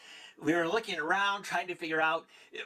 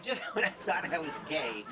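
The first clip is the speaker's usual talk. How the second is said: ordinary delivery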